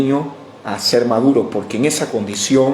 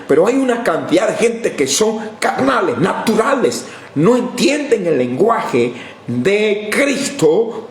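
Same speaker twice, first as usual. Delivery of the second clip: fiery preaching; 7 semitones higher than usual. Acted. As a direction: preach it out to the congregation. (predicación encendida, más aguda y fuerte)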